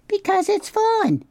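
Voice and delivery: very high, delighted squeal of fun; gleeful opossum. Acted. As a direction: toss it off with glee. Opossum explains pretending.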